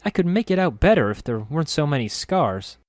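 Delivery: put-on bright girlish voice. Narrator voices Nenny character.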